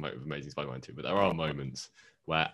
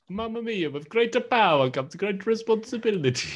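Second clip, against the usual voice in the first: much higher, louder cartoon Italian; mock Italian accent; 6 dB louder than usual. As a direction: ham up a pizza-chef Italian. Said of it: "You Italian, what do you say?".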